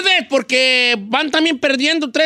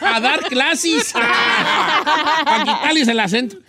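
laughing; 4 semitones higher, slower than usual. Real, not acted. Host laughs at accent classes.

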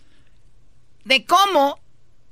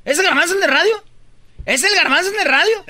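higher, louder playful mock; excited teasing; 6 semitones higher, 4 dB louder than usual. Real, not acted. Mocking Garbanzo to the studio, loud excited tease. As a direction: poke fun at him, loud and gleeful.

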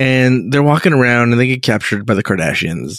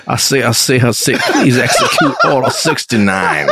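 a hint of a folksy Southern gentleman, chuckling; Southern Emperor. Podcaster redoes the Emperor's order as a Southern gentleman, half-laughing. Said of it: "but he says it like this".

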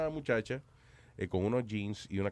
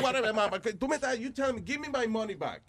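angry demand; 11 semitones higher than usual. Retold or imitated. Demanding money back from manager.

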